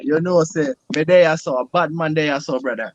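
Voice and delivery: a light Jamaican patois put on for his friends; Jamaican accent. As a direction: speak it in a Jamaican accent, on request. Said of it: "go ahead and speak it".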